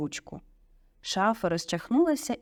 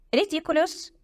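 plain reading voice

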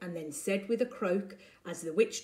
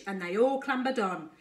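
ordinary delivery

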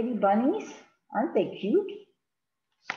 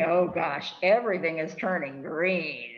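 usual speaking voice

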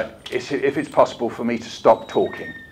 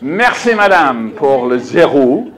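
louder, playful French; mock French thanks; 6 dB louder, slower than usual. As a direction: thank her in a hammed-up French voice. Continues French bit.

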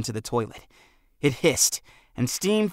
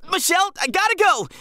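much higher, louder panicked shout; overly loud shout; 4 dB louder than usual. A narrator's reading. Jack shouts to Michelle as his pants burn. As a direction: blurt it out way too loud. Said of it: "he shouted, overly loud".

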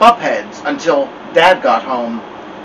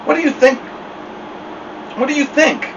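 pressing mother; 12 semitones higher, 4 dB quieter, faster than usual. Acted.

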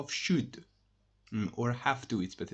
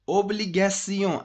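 Obligation said much higher and louder, falling at the end; emphatic; 8 semitones higher, 8 dB louder than usual. Shown as a demonstration. Teacher to class, stressing obligation again loudly. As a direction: punch Obligation hard. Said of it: "as I told you".

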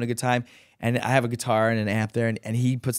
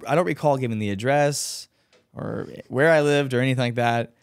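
ordinary talk, nothing marked

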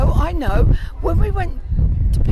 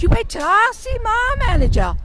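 high, loud mock American threat; exaggerated American telling-off; imitated American accent; 9 semitones higher, 7 dB louder than usual. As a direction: mimic an indignant American lady. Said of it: "Just like that".

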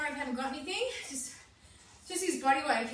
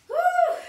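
high, loud woo with a laugh; laughing whoop; 13 semitones higher, 9 dB louder than usual. Real, not acted. Coach whooping and laughing as the class falls back down.

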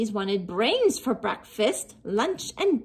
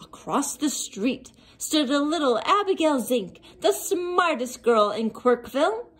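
ordinary delivery, nothing marked

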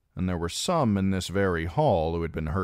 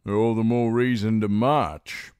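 knight insisting, louder; 5 semitones higher, 6 dB louder than usual. A narrator's reading. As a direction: push the case to march. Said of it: "insisted Humphrey Clifton".

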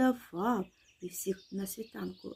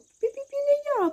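very high squeaky mouse refusal; piping little mouse voice; squeaking mouse; 4 dB louder than usual. A narrator's reading. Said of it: "пищить верть".

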